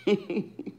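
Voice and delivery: soft, short laugh to herself; real chuckle. Real, not acted. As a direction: chuckle quietly at a private funny thought. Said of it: "I'm just laughing".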